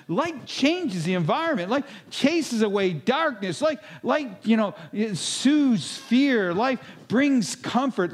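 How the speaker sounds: high, ringing sermon voice that builds; preaching at full force. Acted. Pastor to his congregation, preaching at full force. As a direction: lift the room with each line about light. Preaching about salt and light.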